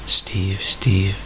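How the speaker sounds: a fading spirit calling him softly; ghostly whisper. A narrator's reading. Dead grandpa whispers his grandson's name, ghostly whisper. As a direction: breathe the name out with no voice.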